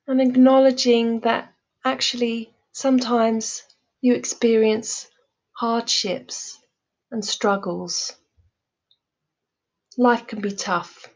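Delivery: calm, measured meditation voice with long pauses; guided meditation. Real, not acted. Teacher leading listeners through loving kindness meditation, calm guided delivery. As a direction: guide them gently, leaving space after each phrase.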